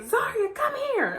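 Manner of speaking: high calling voice, summoning by name; mom calling. Retold or imitated. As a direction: call her like mom.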